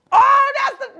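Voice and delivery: high, loud burst; excited recognition. Real, not acted. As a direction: blurt it out as it comes to you. Sudden recognition of an actor.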